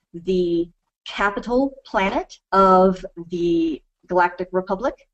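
ordinary delivery

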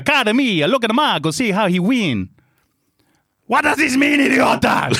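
high, hammed-up Italian storyteller; Italian accent. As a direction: act out the couple's spat in mock Italian. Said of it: "try and do an Italian accent".

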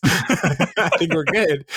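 slow line broken by laughter; laughing. Retold or imitated. Quoting Leslie while laughing, slowly. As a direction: laugh through the words.

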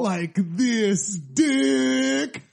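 slow, loud, stretched punchline; hammed-up slow drag; drawn out. Running joke, drawn out.

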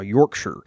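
"Yorkshire" said low as YORK-shur; short, unstressed "shur" ending on "Yorkshire"; British pronunciation. Shown as a demonstration. Summing up the spelling lesson, "Yorkshire" as YORK-shur. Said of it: "that's the pronunciation style".

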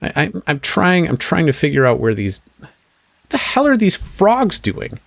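a flustered stranger staring at frogs; bewildered NPC. Acted. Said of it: "He's gonna say".

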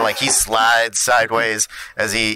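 ordinary talk

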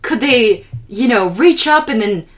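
high, animated imitation of dad; earnest mock pleading, springing up high at the end; enthusiastic dad. Dad pleading for unrealistic softball anime, high and eager. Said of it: "he was so enthusiastic about".

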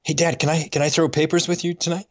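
a lightly voiced eager little boy; hint of a kid pleading with dad; young son asking. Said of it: "he would say".

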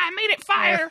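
high, loud hammed-up villain boast; mock Magneto. Morph posing as Magneto, hammed-up boast. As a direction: puff up like a cheap supervillain.

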